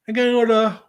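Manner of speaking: words stretched by a yawn. Real, not acted. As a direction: talk through a yawn. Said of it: "excuse me, as I yawn".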